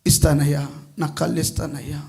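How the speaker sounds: low, soft pleading; acted hushed, self-giving plea; mother's consent. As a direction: give in quietly, imploring. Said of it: "తల్లి అంటుందయ్యా".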